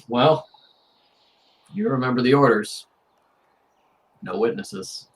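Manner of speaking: loud, commanding father figure; ruthless father. Acted. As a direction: give the order as the one in charge. Game master voices ruthless father's order.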